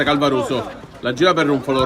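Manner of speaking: ordinary delivery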